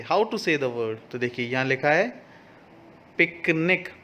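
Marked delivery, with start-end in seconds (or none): none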